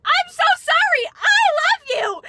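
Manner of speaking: loud high retold apology to Harry; frantic apology. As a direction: gush it out like a starstruck fan.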